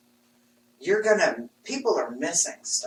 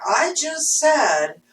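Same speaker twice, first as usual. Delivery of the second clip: emphatic retort; 6 semitones higher, 8 dB louder than usual. Retold or imitated. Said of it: "And I'm like".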